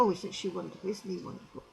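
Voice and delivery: a hushed little "isn't she wonderful"; soft admiring murmur. Retold or imitated. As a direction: murmur it as a quiet aside. Admiring remark repeated quietly.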